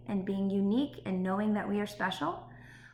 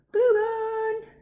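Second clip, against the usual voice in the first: high, loud excited pop on glue gun; playful squeak; 13 semitones higher, 7 dB louder than usual. Real, not acted. Teacher shows off her handy glue gun, squeaking high. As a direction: jump way up in pitch for fun.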